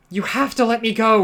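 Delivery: lightly voiced insistent young woman. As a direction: press hard, pleading to be let go. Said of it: "Rogue insisted".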